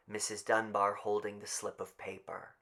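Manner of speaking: usual speaking voice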